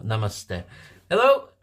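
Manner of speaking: ordinary talk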